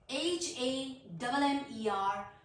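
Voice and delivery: slow letter-by-letter spelling. Shown as a demonstration. Teacher spelling a word for learners, slow spelling. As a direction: spell it out, a small gap after each letter. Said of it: "letter spell करती हूँ".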